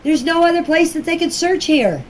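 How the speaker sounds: usual speaking voice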